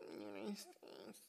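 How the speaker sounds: slow, very soft reluctant answer; ashamed mumble. Retold or imitated. Scene: repeating nationality abroad, slow ashamed mumble. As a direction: barely say it, embarrassed. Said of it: "They'll be like".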